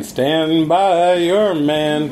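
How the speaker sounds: slow mock-country delivery; drawn out. Mocking the bar friends' advice, slow and drawn out. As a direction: stretch it like a country song. Quoting a song title slowly.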